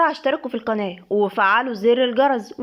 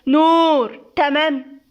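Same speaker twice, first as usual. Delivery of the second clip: loud sharp yell of her name; husband shouting at his wife; 4 semitones higher, 4 dB louder, much slower than usual. A narrator's reading. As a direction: shout to make her listen. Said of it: "سيف بزعيق".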